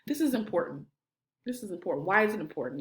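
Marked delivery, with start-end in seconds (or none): 1.4-2.1 dropped lower and softer; softer before bringing the fire; voice getting low. Shown as a demonstration. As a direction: pull them in with a softer, lower voice. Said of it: "You see how my voice is getting low?".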